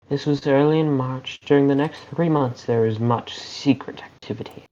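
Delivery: put-on story-reading English accent. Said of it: "I don't know why the English accent worked".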